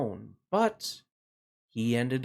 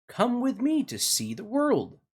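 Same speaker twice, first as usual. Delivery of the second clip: cheerful rooster; 9 semitones higher than usual. Acted. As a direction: call out brightly as the rooster. Rooster voiced in children's story.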